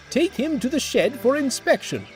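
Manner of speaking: rally the crew; commanding manager. Acted. Manager gives orders.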